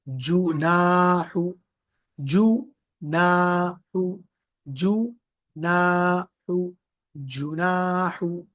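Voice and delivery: जुनाहु slowly, the long आ held and the पेश said with fully rounded lips; rounded lips, stretched. Shown as a demonstration. Teacher demonstrating lip rounding to students. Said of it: "पेश बिल्कुल गोल होंठ के साथ".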